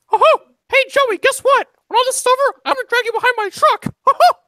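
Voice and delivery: high squeaky gleeful menace; cheerful cartoon mouse threat; Mickey Mouse. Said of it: "You can hear it in the distance".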